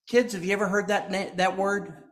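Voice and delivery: usual speaking voice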